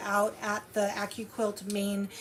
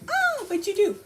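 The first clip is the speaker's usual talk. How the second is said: excited; 9 semitones higher than usual. Real, not acted. Eager question.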